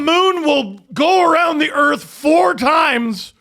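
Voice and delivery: high, slow mock proclamation; slow mock announcement. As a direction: announce it slowly as a joke. Joking absurd claim.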